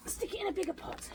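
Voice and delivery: soft whisper to herself; whispered aside. Real, not acted. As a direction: breathe it like a private note. Muttered aside while repotting.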